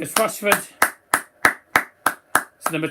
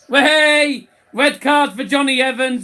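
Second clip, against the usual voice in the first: high, loud burst at the red card; thrilled yell at the sending off; excited shout; 7 semitones higher, 7 dB louder than usual. Real, not acted. Red card shown, excited call.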